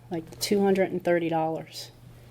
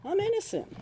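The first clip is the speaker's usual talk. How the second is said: high, soft little voice of innocence; mock innocent; 4 dB quieter than usual. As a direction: squeak out your innocence. Mock plea to police.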